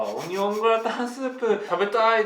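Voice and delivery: imitating a man. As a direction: act out a man's craving. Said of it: "とか言って".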